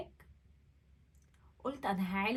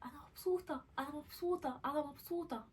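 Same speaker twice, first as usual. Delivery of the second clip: a bit higher, softer affirmation; mirror affirmation; 5 semitones higher, 4 dB quieter than usual. Retold or imitated. Saying affirmations to herself in the mirror, lifted and soft. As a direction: lift the voice gently to yourself. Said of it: "ابص على نفسي في المراية".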